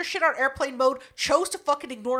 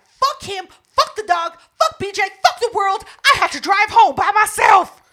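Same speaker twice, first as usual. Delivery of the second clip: louder and higher, yelling each "Fuck" hard; retold furious yelling; 9 semitones higher, 10 dB louder than usual. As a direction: blow up at the whole world, loud and high. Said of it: "I was pissed".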